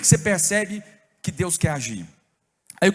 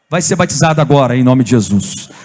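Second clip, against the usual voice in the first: loud prayer; 7 semitones lower, 9 dB louder than usual. Retold or imitated. Reenacting loud prayer over man.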